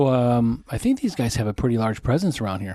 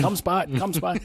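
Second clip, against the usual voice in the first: higher-pitched dog call through laughter; Bill Clinton; 6 semitones higher than usual. Acted. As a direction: jokingly call a dog while cracking up.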